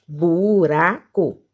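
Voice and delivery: "Buraco" slowly split, bu-ra-co; syllable by syllable. Shown as a demonstration. Teacher testing for two syllables, "Buraco" slowly separated. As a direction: stretch out each syllable of "Buraco". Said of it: "não".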